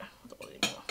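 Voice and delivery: unvoiced whisper; whisper aside. Real, not acted. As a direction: murmur it off mic.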